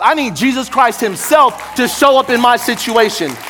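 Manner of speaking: high, loud preacher's cry; defiant shout of faith. Acted. Preacher crying out to the congregation, a loud defiant demand. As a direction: insist on God at full voice.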